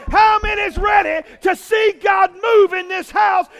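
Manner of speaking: high, loud rallying cries to the church; preaching shout. Real, not acted. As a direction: whip the room up, full force. Pastor whipping up congregation.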